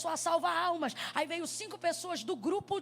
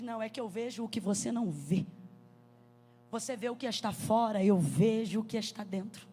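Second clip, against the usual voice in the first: low calm answer; 6 semitones lower than usual. Retold or imitated. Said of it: "Você diz".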